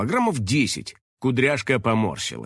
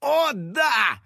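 high-pitched triumphant cry; excited high cheer; exclamation; 10 semitones higher than usual. A narrator's reading. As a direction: exclaim it high, stretching the vowels. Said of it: "воскликнул Финик".

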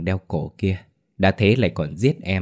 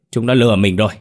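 louder furious outburst at being cheated; chúng nó lừa mình rồi shouted louder in rage; angry; 3 semitones higher, 6 dB louder, faster than usual. Acted. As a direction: slam it out, louder the second time. Repeated cry of being cheated.

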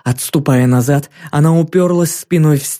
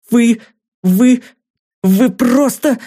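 much higher, stammering outrage; sputtering anger; 10 semitones higher, slower than usual. A narrator's reading. Irbis too polite to unleash his anger, higher stammering outrage. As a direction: sputter with rage you can't voice. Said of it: "слишком воспитан, чтобы обрушить".

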